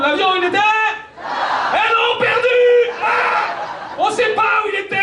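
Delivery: high, shouted disbelief; frantic shouting. Acted. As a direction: yell it like it's insane. Frantic climax of rant.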